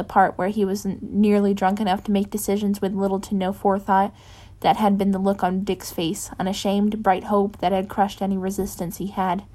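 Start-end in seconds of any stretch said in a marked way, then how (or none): none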